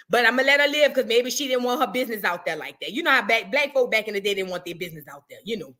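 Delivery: normal talking voice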